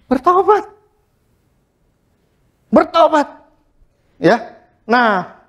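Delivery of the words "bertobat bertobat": high ringing call to repent; rising call. Real, not acted. Preacher calls the congregation to repent, high and ringing. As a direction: lift the word up high as an appeal. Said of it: "Mari kita".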